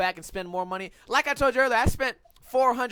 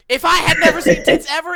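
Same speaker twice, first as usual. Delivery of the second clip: worked-up outburst; 7 semitones higher, 11 dB louder than usual. Real, not acted. Incredulous retort.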